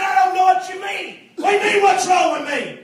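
high-pitched, indignant back-and-forth; heated exchange. Retold or imitated. Retold back-and-forth confrontation.

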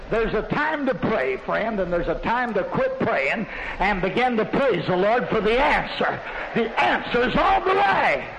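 preacher rising to a shout; preaching crescendo. Real, not acted. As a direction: drive it home to the congregation.

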